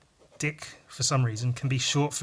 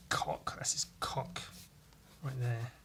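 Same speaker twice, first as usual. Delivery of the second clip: soft unvoiced whisper, slow; 4 semitones lower, 13 dB quieter than usual. Real, not acted. Muttering to himself over the drawing, whispering. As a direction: breathe it under your breath.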